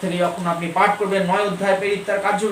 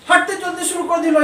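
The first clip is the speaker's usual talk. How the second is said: emphatic preaching repeat; 8 semitones higher than usual. Acted. Preacher repeats the line twice.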